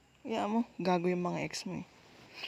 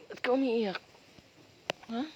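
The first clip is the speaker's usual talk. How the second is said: higher, louder worried question; alarmed concern; 4 semitones higher, 6 dB louder than usual. Acted. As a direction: ask with sudden worry why they are crying. Sees partner crying, asks why. (nag-aalalang tanong, mas malakas)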